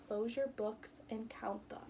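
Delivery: softly whispered instruction; whispering to the class to count sounds. Shown as a demonstration. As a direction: whisper it so they listen. Quiet listening task.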